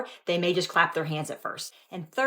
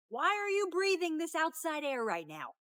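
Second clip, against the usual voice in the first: mock preachy alarm; 11 semitones higher, 5 dB quieter, slower than usual. Skit, preachy critic mocking.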